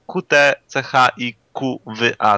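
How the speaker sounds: slow, separated letter names; spelled out slowly. Real, not acted. Reading a viewer's odd nickname to co-hosts, spelled out slowly.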